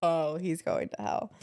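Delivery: usual speaking voice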